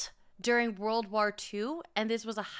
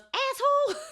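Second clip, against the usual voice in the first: much higher, louder mock yell; sister's insult; 3 dB louder than usual. Sister cutting off a driver in traffic, high mock yell. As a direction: screech it at the other driver. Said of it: "she goes".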